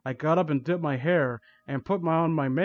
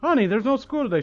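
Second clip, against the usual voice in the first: mildly acted higher, hurried mom voice; shakily; 7 semitones higher, faster than usual. A narrator's reading. Mom tells daughter about school, mildly shaky. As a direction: lightly play an unsettled mother. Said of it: "she said shakily".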